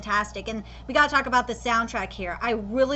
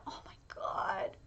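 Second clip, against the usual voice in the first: awed whisper; 14 dB quieter than usual. Real, not acted. Overwhelmed by the soundtrack.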